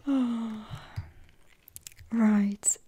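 normal talking voice

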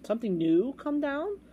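high, rising astonished question; gasping surprise. A narrator's reading. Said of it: "Bartholomew gasped".

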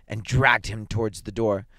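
plain reading voice

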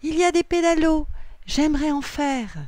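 high, loud, eager request; excited child. A narrator's reading. Noah asks to go pedal boating, excited child.

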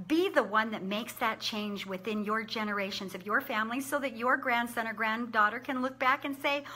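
normal talking voice

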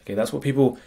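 usual speaking voice

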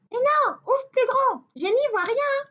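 high, quick teasing rabbit; very high cheeky bunny; little rabbit voice. Acted. (voix aiguë de petit lapin)

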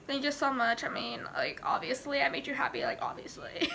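blasé, self-satisfied brush-off; mock offhand. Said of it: "or just be like".

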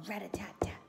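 crisp whispered sound effect; whispered tapping. Acted. Mysterious noise in the story, whispered tapping. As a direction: whisper it like little taps.

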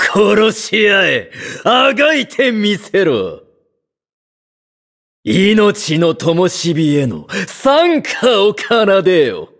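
high-pitched, frenzied villainous command; exultant villain. Acted. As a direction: revel in the slaughter, voice pitched right up. Villain commands a deadly fight. (狂喜して高い声で煽る悪役)

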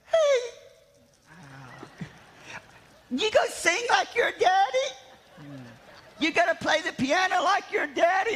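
high-pitched, lightly voiced old church lady; little grandmothers. Grandmothers asking the preacher's son about his daddy, in a high, lightly voiced old-lady tone. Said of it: "these little grandmothers would come up to me and they would go".

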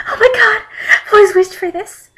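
high, loud mock crying; hammed-up tearful squeal; starting to cry. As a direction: wail it like overjoyed tears. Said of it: "I began to cry".

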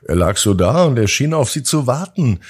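usual speaking voice

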